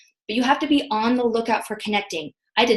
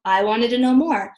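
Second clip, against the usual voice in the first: normal talking voice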